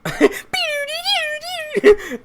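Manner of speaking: high squealed musical noise; mock music noise. Real, not acted. Imitates music at the game.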